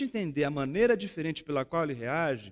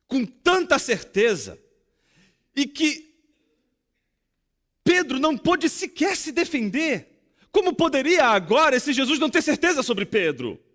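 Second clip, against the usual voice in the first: higher and louder, urgent rhetorical questions; fervent preacher building to a peak; preaching at full force; 7 semitones higher, 7 dB louder than usual. Acted. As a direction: drive the questions home to the congregation. Preaching climax, rising.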